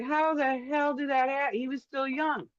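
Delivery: can't believe he's gone; shocked disbelief. Retold or imitated. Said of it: "I'm like".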